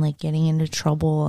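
ordinary talk, nothing marked

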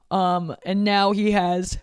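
words stretched through a yawn. Real, not acted. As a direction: talk slowly mid-yawn. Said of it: "sorry to yawn".

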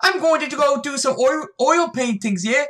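high-pitched, badly faked Irish accent. Shown as a demonstration. Friend tries the line on request, in a bad Irish accent. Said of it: "Say it in an Irish accent".

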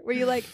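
mock gasp for air; a quick hammed-up gasp. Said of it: "were you gasping for air".

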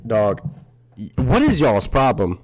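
loud, fed-up bark at the falling merch; exasperated outburst. Real, not acted. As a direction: snap at the things that keep falling.

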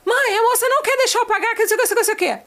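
high-pitched imitated complaint to mother; complaining child. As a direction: act a kid reporting a refusal. Son's complaint acted out.

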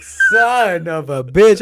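slow, stretched curse as the high hits; slow curse as the pill kicks in; drawn-out curse. Retold or imitated. Drug kicking in.

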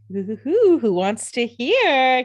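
hammed-up giddy crowing, stretching the last word; giddy excitement. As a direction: whoop it like an overexcited schemer. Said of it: "he's just so excited to have a plan".

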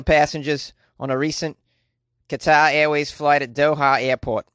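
badly faked Australian accent; terrible Australian accent. News host quoting the Australian government, bad Australian accent. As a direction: read it out in a poor attempt at Aussie English. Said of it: "a terrible Australian accent".

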